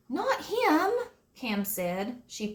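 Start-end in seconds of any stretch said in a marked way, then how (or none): 0.1-0.9 put-on high little-girl voice; lightly voiced young girl; child voice. A narrator's reading. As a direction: voice Cam high and eager. Cam correcting the police.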